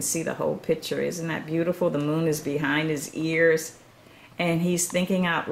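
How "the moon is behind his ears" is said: ordinary delivery, nothing marked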